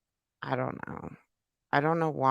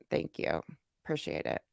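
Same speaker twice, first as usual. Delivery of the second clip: breathy whisper of thanks, almost off mic; 5 semitones lower, 9 dB quieter than usual. Real, not acted. Streamer to chat donors, whispering thanks. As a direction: drop to a whisper and murmur the thanks.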